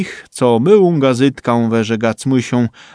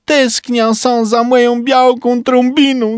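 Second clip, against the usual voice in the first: put-on much higher, plaintive voice of a longing old man; high, wistful character voice confessing his homesickness; homesick Trumba; 10 semitones higher than usual. A narrator's reading. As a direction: sigh out the longing for home and wife.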